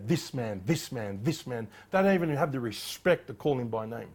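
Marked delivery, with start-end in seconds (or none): 0.1-1.7 quiet disdainful "This man" repeated; scornful this man. Retold or imitated. Pharisees refusing to name Jesus, quiet scorn. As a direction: sneer "this man" low and dismissive. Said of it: "all they say".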